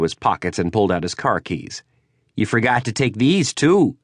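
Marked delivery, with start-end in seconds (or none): 2.4-4.0 higher, rising taunt; taunting rival. Acted. Prudell taunting as he throws the keys, rising mock. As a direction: spit the last word.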